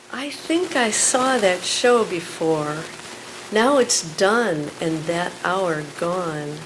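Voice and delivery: loud, emphatic spoken word; poem performance. Acted. Performs poem Killing Time.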